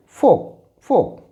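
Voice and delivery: फोक said as fok, no l; silent l demo. Shown as a demonstration. Teacher showing students the silent l in फोक. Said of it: "इसे हम पढ़ते हैं".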